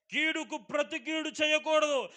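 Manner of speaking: loud high proclamation of the rule; proclaimed. Acted. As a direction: declare it to the whole church. Proclaimed then repeated softly. (కీడుకు ప్రతి కీడు చేయకూడదు అని గట్టిగా ప్రకటించడం)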